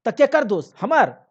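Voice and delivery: loud, high indignant demand; sharp loud accusing question; angry retort. Acted. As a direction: throw the blame back at him, loud. Wife angrily blames husband.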